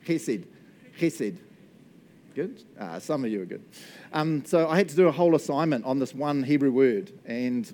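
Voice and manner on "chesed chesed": "Chesed" with a guttural scraped ch; teaching "Chesed" slowly, throaty ch first; throaty pronunciation demo. Shown as a demonstration. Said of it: "get your throat going".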